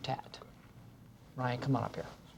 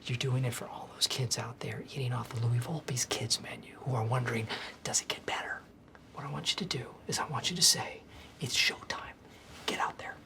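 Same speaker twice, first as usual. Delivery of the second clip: low, half-whispered urging; hushed pep talk; 5 semitones lower, 8 dB quieter than usual. Acted. Boss talking a scared host into going out, hushed. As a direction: coax them softly and earnestly, barely voiced.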